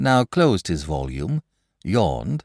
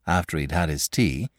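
plain reading voice

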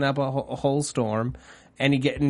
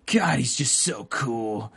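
starry-eyed fanboy voice, higher; gushing inner monologue; 6 semitones higher than usual. Retold or imitated. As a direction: gush over him like a dazzled fan. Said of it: "what your inner monologue sounds like".

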